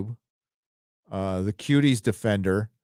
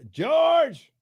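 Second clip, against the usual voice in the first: calling out loud. Real, not acted. Streamer calls out to George in chat, loud. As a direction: call George's name up high. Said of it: "That's as loud as I can get".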